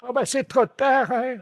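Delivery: put-on old lady voice. Prankster voices the seagull-eating lady.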